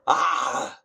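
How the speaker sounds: sudden loud high yell; loud shaking-off shout. Real, not acted. Vlogger jolting himself awake on stream with a loud shout. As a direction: blast out a wake-up yell.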